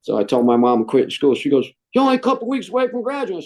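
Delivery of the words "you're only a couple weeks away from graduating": quick, high imitation of his mother; mom's protest. Mother protesting her son quitting school, quick and high. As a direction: rattle it off high like a worried mom.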